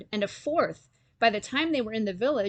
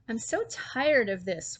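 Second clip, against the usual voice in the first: ordinary delivery, nothing marked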